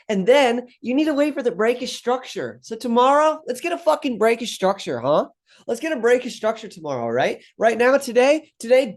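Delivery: high, fast and wound up, words tumbling; a Shia LaBeouf-style frenzy, way up in pitch; hyped-up rant. Real, not acted. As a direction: rev yourself up like a manic trader pep-talking himself. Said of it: "I sound like Shia LaBeouf".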